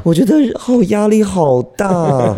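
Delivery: high, very slow drawn-out complaint; dramatic groan. Real, not acted. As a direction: drag it out in mock despair. Dreads evening classes.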